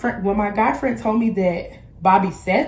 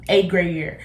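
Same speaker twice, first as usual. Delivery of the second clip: ordinary delivery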